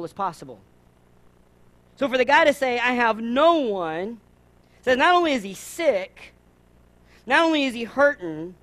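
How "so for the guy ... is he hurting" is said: emphatic preaching. Real, not acted.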